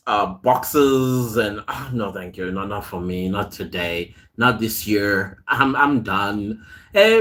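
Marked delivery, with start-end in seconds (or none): 1.7-5.4 soft, low mock refusal, shaking it off; a low, quiet no thank you. Refusing the chore to her live viewers, quiet and low.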